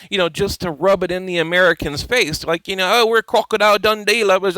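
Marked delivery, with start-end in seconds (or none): 2.9-4.6 mock Australian accent. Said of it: "played up their little Australian accent".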